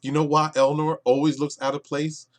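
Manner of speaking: ordinary delivery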